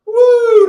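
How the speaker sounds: high, loud excited whoop. Real, not acted. Streamer whoops at the drug story, loud high whoop. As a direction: let out a big whooping yell.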